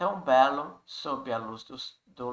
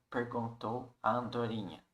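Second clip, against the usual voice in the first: ordinary talk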